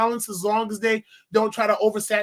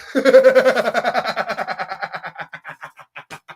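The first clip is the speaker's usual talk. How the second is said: shouting laughter; loud laugh; 5 dB louder than usual. Real, not acted. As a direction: crack up loudly.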